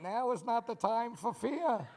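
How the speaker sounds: a masked villain intoning doom; dad in the CPAP mask answering his kids; Bane voice. Retold or imitated. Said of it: "you look like Bane".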